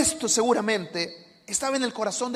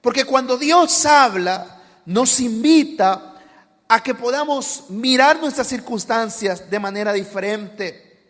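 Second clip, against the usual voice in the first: louder, slow fervent sermon voice; full-force preaching; 2 semitones higher, 11 dB louder, slower than usual. Acted. Preacher urging the congregation to rejoice, loud and slow. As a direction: build it up and urge them to rejoice.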